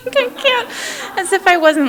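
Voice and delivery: high, laughing burst through the words. Real, not acted. Laughing at own denial.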